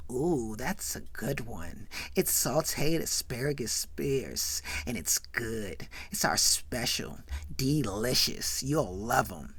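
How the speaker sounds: low, soft, slow put-on waitress. Waitress recommending the special to a diner, soft waitress voice. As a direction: savour the dish slowly and softly. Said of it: "And the waitress says".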